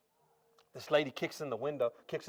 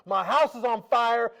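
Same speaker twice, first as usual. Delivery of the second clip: urgent shout; 10 semitones higher, 11 dB louder, slower than usual. Retold or imitated. Dad tells the officer urgently.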